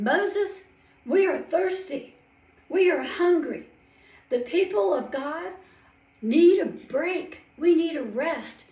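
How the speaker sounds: high, loud whiny demand; calling out complaining. Acted. Hungry grumbling people calling out to Moses, loud and high. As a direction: hammer each "we" like a fed-up crowd. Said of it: "they were calling out to Moses".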